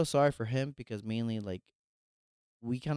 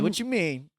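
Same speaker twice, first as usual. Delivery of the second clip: shocked reply; 11 semitones higher, 7 dB louder, slower than usual. Retold or imitated. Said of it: "I'm like".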